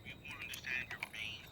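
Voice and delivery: breathy whisper to the dark; nervous night vlog whisper. Real, not acted. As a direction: whisper it gently and carefully. Whispering to a spirit.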